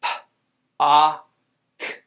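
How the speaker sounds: "P, A, K" as isolated sounds; puffed P, loud open "ah", clicked K, long gaps; sounded out. Shown as a demonstration. Teacher sounds out first syllable, sounded out. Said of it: "sound out the first syllable".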